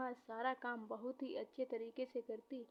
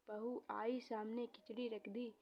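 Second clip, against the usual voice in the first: ordinary delivery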